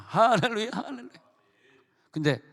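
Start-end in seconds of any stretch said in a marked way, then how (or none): 0.1-1.1 high, rising cries of praise; joyful hallelujah. Retold or imitated. A pardoned sinner praising God, excited and high. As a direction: burst out in grateful joy.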